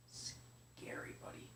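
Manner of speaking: soft unvoiced whisper to son. Real, not acted. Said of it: "aside to son".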